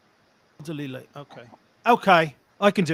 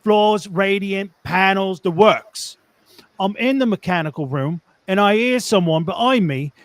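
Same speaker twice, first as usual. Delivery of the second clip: put-on Cockney storytelling; Cockney accent. Reading a tradesman's story on request, Cockney accent. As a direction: read it like a London Cockney. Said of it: "and a Cockney accent".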